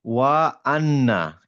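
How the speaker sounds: আন্না with a held nasal n; recitation demo. Shown as a demonstration. Teacher correcting a student, আন্না slowly drawn out. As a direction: chant ওয়া আন্না slowly, dwelling on the নুন. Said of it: "ছোট জিনিস ঠিক করি".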